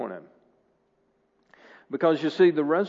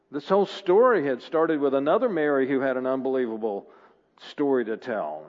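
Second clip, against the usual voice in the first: ordinary talk, nothing marked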